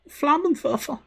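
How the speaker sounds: very high, hammed-up German accent; camp German accent. Streamer shows off German word, super camp German accent. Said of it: "My super camp German accent".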